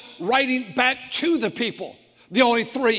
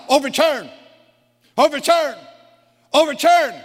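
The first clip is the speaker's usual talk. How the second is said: each Overturn higher and louder, from firm to near shout; climbing preacher's cry of "Overturn"; rising emphatic repetition; 4 semitones higher, 4 dB louder than usual. Real, not acted. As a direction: hammer "Overturn" home, building with every repeat.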